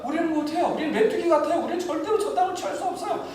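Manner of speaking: high pleading complaint of the ten spies; whining spies. Retold or imitated. Preacher voicing the fearful spies, high and whining. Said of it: "열 명은 어떻게 해요?".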